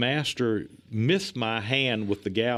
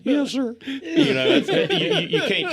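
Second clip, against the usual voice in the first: much higher, louder mock teary yes sir; mock quivering; 7 dB louder than usual. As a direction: imitate a lip-trembling meek reply. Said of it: "your bottom lip's doing like this".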